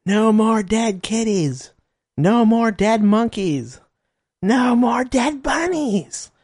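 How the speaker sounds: high, drawn-out imitation of chanting protesters; mock protest scream. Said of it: "they'd be out there screaming".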